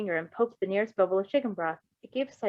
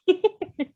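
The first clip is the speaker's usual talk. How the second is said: high delighted laugh; 11 semitones higher, 5 dB louder than usual. Real, not acted. Reader laughs at scene.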